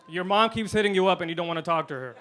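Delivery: normal talking voice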